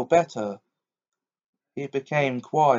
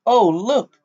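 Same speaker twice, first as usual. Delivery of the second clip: Polly crying out at the wood; cried out; 5 semitones higher than usual. Acted. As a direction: call out in surprise. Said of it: "Polly cried out".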